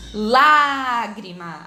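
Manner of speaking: Lágrima with a long, loud first Á; stressed syllable stretched. Shown as a demonstration. Teacher showing the strongest syllable, Lágrima with LÁ drawn out. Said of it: "A mais forte da palavrinha".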